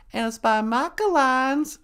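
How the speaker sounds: normal talking voice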